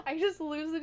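losing it laughing mid-sentence; real giggly outburst; breaking into laughter. Real, not acted. Can't keep straight face at game intro.

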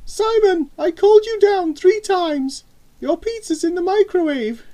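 very high, loud mum voice; scolding mother. A narrator's reading. Mother telling off son late for dinner, scolding. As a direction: scold a child loudly in a high voice.

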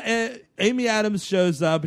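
ordinary talk, nothing marked

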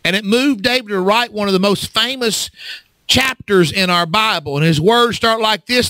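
usual speaking voice

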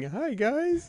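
très aigu, petite voix d'enfant; little kid. Retold or imitated. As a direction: imitate a little kid popping in to say hi. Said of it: "little man running in like he did".

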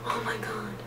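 whispered in disbelief; awed whisper. Real, not acted. Whispering in awe at the primer gift. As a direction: breathe it out in a whisper.

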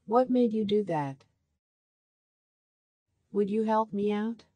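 ordinary talk, nothing marked

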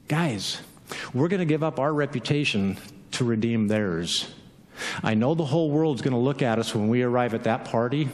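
low, softening gradually toward a near whisper; sincere and unhurried, pitched well down, fading at the end; calm earnest reassurance. Acted. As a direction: settle them down with quiet conviction. Jesus calmly answers panicking disciples.

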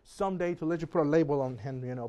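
ordinary delivery

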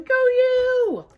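high, loud squeaky cheer; little mouse voice. Acted. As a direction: cheer like a tiny excited mouse. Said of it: "You see the little mouse going".

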